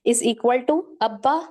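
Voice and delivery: slow "ابا" split as ab-ba with a pressed با; doubled ba. Shown as a demonstration. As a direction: stretch it out, doubling the با. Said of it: "دو بار پڑھنا".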